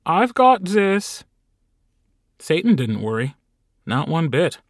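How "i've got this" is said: high, slow, breathless strain; gasping. Acted. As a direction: gasp it out under a heavy load. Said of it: "Carl gasped".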